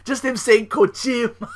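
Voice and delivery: high laughing voice. Real, not acted. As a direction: crack up while talking. Laughing at own impression.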